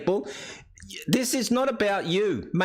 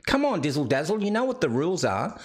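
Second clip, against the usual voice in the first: normal talking voice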